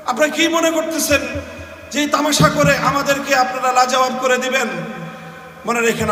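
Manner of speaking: normal talking voice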